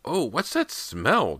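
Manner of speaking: high, slow, drawn-out demand. A narrator's reading. Boy reaching the stinking bathroom, demanding. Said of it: "James demanded".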